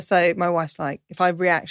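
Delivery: ordinary talk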